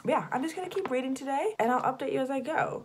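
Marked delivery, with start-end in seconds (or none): none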